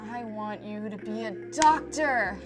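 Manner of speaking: lightly voiced insistent grandmother, drawn out. Grandmother on a career in animation, imitated insistent demand. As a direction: stretch the words slowly, a mock grandmotherly demand.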